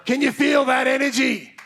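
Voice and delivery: high, rousing crowd hype; excited hype. Real, not acted. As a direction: whip up the room's energy. Pumping up the crowd.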